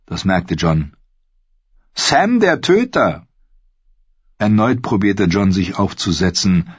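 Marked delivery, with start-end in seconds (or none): 1.9-3.3 hoch und langsam ausgerufen; bemüht fröhlicher Ausruf. A narrator's reading. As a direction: ruf den Beinamen feierlich, hoch gezogen. Gren ruft den Beinamen bemüht fröhlich. (gedehnter, hoher Ausruf)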